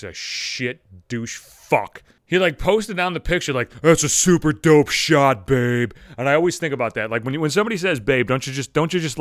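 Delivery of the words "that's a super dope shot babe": slow, loud, doltish mock voice; dumb boyfriend voice. Boyfriend commenting on the girl's picture, dumb voice. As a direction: imitate a douchey boyfriend, loud and dragged out. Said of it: "babe in that dumb fucking voice".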